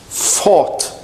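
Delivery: "fought" with a long, clear F; F sound. Shown as a demonstration. Teacher puts it after I for learners, "fought" with F. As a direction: lean on the F opening "fought". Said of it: "Com som de F".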